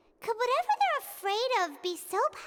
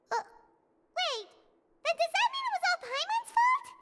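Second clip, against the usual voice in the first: dawning alarm; 9 semitones higher than usual. Acted. Paimon to Wriothesley, suddenly worried she caused it, startled. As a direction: realize it might be your fault. Paimon realizes it's her fault.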